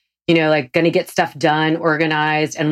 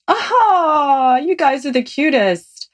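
high-pitched, adoring coo; sweetly melting, high voice; affectionate gushing; 9 semitones higher, slower than usual. Real, not acted. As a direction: gush over an adorable couple, pitch way up. Gushing over the couple.

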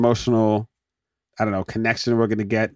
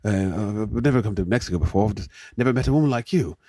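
Hugh Grant; 2 semitones lower, 4 dB quieter than usual. Retold or imitated. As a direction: stammer an awkward, apologetic confession. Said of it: "Hugh Grant said".

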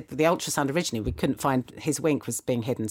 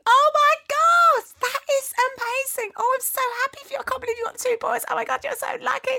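squealing, much higher mock-gushing woman, louder on the first words; gushing stranger; 5 dB louder than usual. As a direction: gush over the twin boys like a giddy stranger. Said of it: "She went off into an absolute tailspin".

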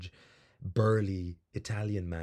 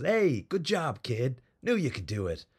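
louder, higher, slowed hearty call of praise; Italian guy cheering; 6 semitones higher, 6 dB louder than usual. Retold or imitated. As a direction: lean over and boom out the praise like a burly fan. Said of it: "leans over and goes".

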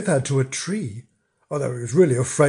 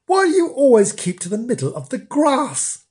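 higher, wondering question; astonished; 8 semitones higher than usual. A narrator's reading. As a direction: ask in mild amazement, pitch lifted. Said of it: "Rosie was most astonished".

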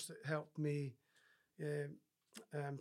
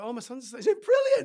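gushing, much higher and louder, overjoyed; mock proud parent; 9 semitones higher, 13 dB louder than usual. Parent boasting that their son is dyslexic, in a gushing mock cheer. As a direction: ham up a parent bragging about the news.

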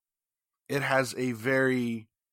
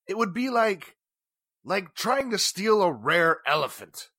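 rising high, indignant and scornful; indignant outburst; 6 semitones higher than usual. Acted. Businessman scoffing to the inspector that nobody could steal the famous stone, rising indignation. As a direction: scoff at the very idea, pitch climbing. Outburst about the diamond theft.

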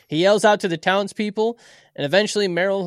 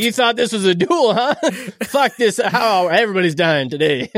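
mock villain; 3 semitones higher, 5 dB louder than usual. Voicing the villain with a rocket launcher.

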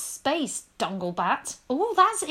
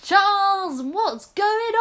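deafened calling; 6 semitones higher, slower than usual. Acted. Deafened friend calls out.

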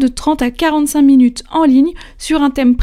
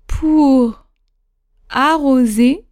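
dictating; much slower than usual. Shown as a demonstration. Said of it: "c'est parti pour cette huitième dictée".

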